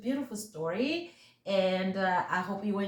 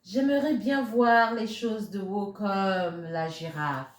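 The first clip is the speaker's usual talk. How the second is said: French story reading; 4 dB louder than usual. A narrator's reading. Reading the French version to children, lilting storyteller voice. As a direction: tell the tale to little ones in French.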